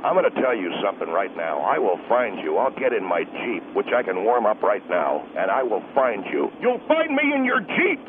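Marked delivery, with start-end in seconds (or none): 0.0-6.5 much lower, fast threatening husband; menacing husband. Acted. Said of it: "I'm the husband of the lady".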